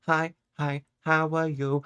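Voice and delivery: usual speaking voice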